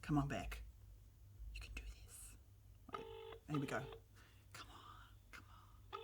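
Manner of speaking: very soft, near-whispered pep talk; hushed urging. Real, not acted. Host willing Beck to pick up the phone, hushed urging. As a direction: whisper it under your breath.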